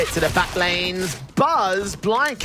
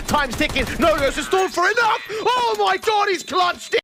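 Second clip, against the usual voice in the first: screaming clutch call; 12 semitones higher than usual. Real, not acted. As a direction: yell it like the crowd erupts. Caster screams as the clutch is won.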